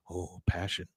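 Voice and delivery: low, hushed aside; mock whisper. Hank confides in Boomhauer, mock whisper. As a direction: murmur it like it's a big deal. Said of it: "Hank whispers to Boomhauer".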